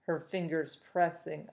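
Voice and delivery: usual speaking voice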